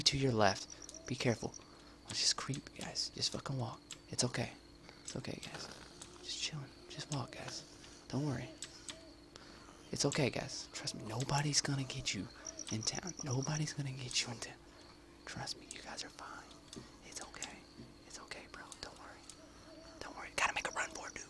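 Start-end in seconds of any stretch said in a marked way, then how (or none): 1.1-20.3 whispered mock comfort, softer and softer; hushed fake friendly reassurance to enemy players; fake reassuring whisper. 20.4-21.2 high hushed whisper; sneaky whisper. Pretend.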